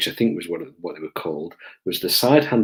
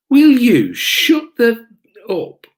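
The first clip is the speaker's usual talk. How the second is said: high, loud, slow imitated outburst; exasperated mock shout; 7 dB louder, slower than usual. Handler tells the whining suspect off, shouting in exasperation. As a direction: space it out like a fed-up handler.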